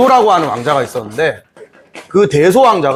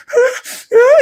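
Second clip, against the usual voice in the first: 분한 울음소리. Acted. 대소가 강 건너에서 분통.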